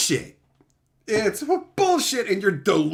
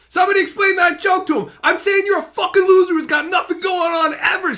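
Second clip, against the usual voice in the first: mocking shout; 6 semitones higher, 4 dB louder than usual. Real, not acted. Insulting a viewer.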